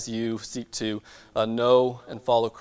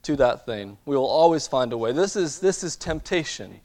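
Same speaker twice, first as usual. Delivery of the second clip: preaching; 5 semitones higher, 5 dB louder than usual. Acted. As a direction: drive the point home from the pulpit. Preaches the lesson of temptation.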